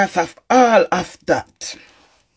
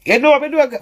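ordinary delivery

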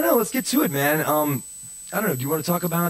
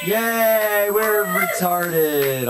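mock cheer; 7 semitones higher, 4 dB louder, much slower than usual.

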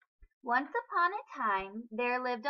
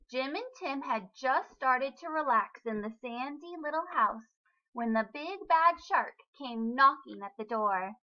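plain reading voice

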